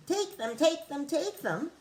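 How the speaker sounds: the cave echoing the rat's words. Acted. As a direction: echo it back, high. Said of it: "came back the welcome sound".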